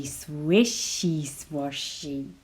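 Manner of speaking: usual speaking voice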